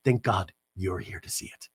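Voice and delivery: low, soft mock sincerity; wife answering at the sunset, soft mock tenderness; mock tender reply. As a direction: hush it like a soap scene. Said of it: "Heather goes".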